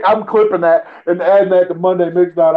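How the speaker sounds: ordinary talk, nothing marked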